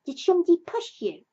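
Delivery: high, loud and eager; a small child bursting with excitement; little Roo squeaking with excitement; excited. A narrator's reading. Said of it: "said Roo excitedly".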